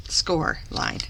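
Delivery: ordinary talk, nothing marked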